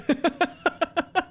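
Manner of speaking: high, bursting staccato laugh. Real, not acted. Gamer amused by their own remark, high laughing. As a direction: crack up in a run of high ha-has.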